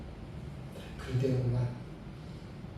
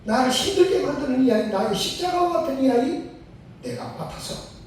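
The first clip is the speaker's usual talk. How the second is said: resolute decision; 8 semitones higher, 3 dB louder than usual. Retold or imitated. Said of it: "하고 마음에 결단을 한 거예요".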